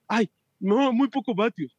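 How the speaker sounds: imitating a frustrated rider who complains about his watts; mock whiny cyclist. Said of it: "la gente se frustra porque". (quejica burlón, más agudo)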